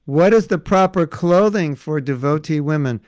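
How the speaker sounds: normal talking voice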